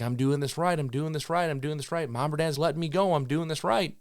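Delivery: lightly voiced happy dog; dog's inner voice. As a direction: play the dog proud of itself. Voicing the dog's thoughts.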